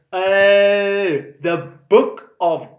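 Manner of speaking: high, loud drawn-out eureka; slow, high mock amazement; realisation. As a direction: stretch it out in delighted discovery. Sudden realisation reading contents.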